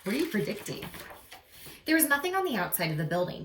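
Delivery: ordinary delivery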